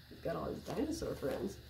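ordinary talk, nothing marked

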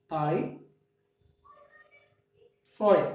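party and forty with tapped t sounds; flap T drill. Shown as a demonstration. Teacher leading a repeat-after-me drill. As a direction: say party and forty with quick d-like taps. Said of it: "repeat after me".

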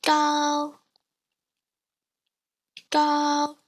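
高 held long, high and level; slow demonstration of 高 with a high level tone; slow first tone. Shown as a demonstration. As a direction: sustain 高 on one high, flat pitch. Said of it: "Now let's do this slowly".

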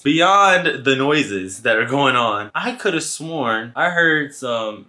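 ordinary talk

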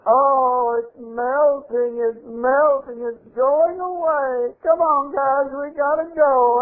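high, drawn-out hammed-up wail; wailing demon. Defeated demon flees the broken curse, mock wailing. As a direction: stretch the words in a theatrical melting cry. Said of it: "The demon said".

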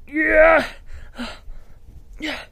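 usual speaking voice

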